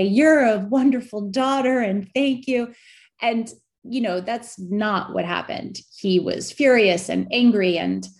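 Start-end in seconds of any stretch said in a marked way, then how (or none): 0.0-2.7 lightly voiced grateful father, pitched higher; a hint of a father's thankful voice; imagined father.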